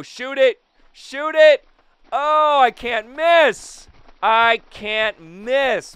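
high, loud, drawn-out crowing; loud, giddy celebration; elated boasting. Real, not acted. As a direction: crow it slowly in triumph. Celebrating unmissable hitting.

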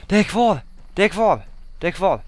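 high, loud repeated cheer; excited relief. Real, not acted. Player finds it is still there, shouting in relief. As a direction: cry out in relief, easing slightly with each repeat.

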